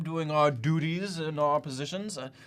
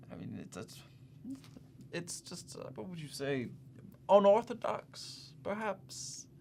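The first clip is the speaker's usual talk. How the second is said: groping for a tactful word, softly; stall, then offer the word gingerly; hesitant; 3 semitones higher, 7 dB quieter than usual. Acted. Groping for a polite criticism.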